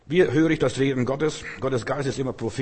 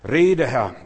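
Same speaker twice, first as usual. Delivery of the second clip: loud, earnest cry to the Lord; loud praying cry at a crossroads; fervent prayer call; 2 semitones higher, 9 dB louder than usual. Acted. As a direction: pray it out boldly and loud. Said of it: "wenn du an einer Weggabelung stehst".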